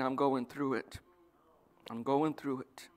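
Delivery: low, subdued resignation; soft and low, weighed down; defeated complaint. Acted. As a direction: sigh it out like someone worn down by troubles. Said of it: "it's the same words, but it's a totally different concept".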